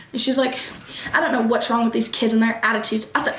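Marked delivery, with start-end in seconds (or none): none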